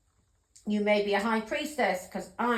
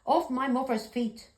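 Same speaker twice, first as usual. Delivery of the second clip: ordinary delivery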